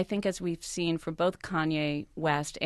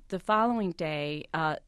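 ordinary talk, nothing marked

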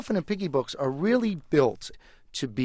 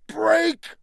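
very high, loud shriek; shocked cry; 5 dB louder than usual. Acted. The toy breaks.

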